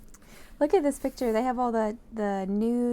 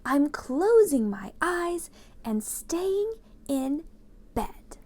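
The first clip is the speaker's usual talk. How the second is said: high, slow, half-whispered sulk; sulky groundhog; 6 semitones higher, 4 dB louder, much slower than usual. Acted. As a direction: pull the covers up, grumbling. Groundhog refusing from bed.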